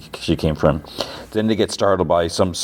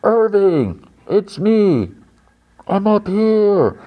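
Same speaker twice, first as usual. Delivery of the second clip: mock distant calling; 13 semitones higher, much slower than usual. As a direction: imitate calling down from far off. Mark calls down from cliff top.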